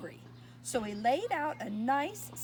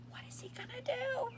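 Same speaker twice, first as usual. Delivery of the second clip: high, hushed whisper full of suspense; suspenseful whisper; 11 semitones higher, 7 dB quieter than usual. Real, not acted. Reader whispering to the child as Harold falls. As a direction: whisper the question to build suspense.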